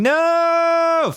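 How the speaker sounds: very loud, very high sustained no; bellow. A narrator's reading. Everyone bellowing as the body turns to ashes, loud and high. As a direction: bellow it in horror. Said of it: "everyone bellowing".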